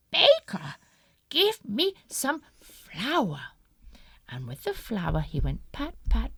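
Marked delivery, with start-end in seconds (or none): 0.1-3.4 wolf demanding. Acted. Wolf ordering baker.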